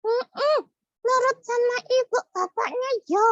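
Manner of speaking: put-on squeaky child puppet, cheerful farewell; high puppet goodbye. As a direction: wave the kids off as the puppet.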